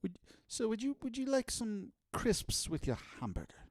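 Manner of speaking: high, soft British server; playfully prim British drive-thru voice; mock British accent. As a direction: put on a dainty, proper British accent. Continues the British drive-thru worker.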